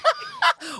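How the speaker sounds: very high squeal of laughter; real squealing laugh. Real, not acted. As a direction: break into a high-pitched laugh. Laughing at friend's remark.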